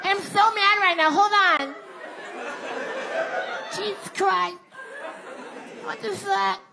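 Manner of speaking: very high voice, fading from mock anger to soft; mad wife cooling down. Wife calming herself after the gas-tank rant, mock anger fading. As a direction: squeak out the temper, then let it die away. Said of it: "She comes in mad".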